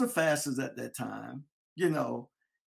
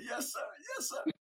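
crying reply; 10 semitones higher, 8 dB quieter than usual. Retold or imitated. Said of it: "I said".

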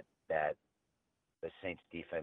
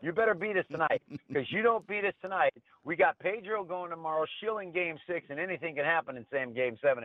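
much higher, hammed-up cocky ballplayer; cocky player taunt; 10 semitones higher than usual. Retelling the player taunting the Yankees, hammed-up and much higher. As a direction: crow it like a brash player taunting rivals. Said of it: "He's sitting there going".

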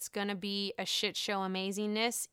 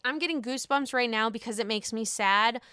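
normal talking voice

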